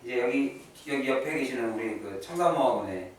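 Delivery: normal talking voice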